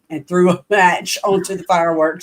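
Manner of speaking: ordinary talk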